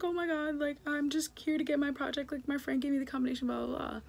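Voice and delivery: high, fast, frantic pleading to the police; panicked plea. Retold or imitated. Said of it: "I was like".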